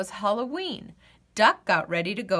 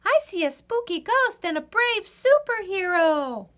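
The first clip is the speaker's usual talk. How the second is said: squirrel calling; 10 semitones higher, 4 dB louder than usual. Acted. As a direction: call down from a branch. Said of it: "called a squirrel".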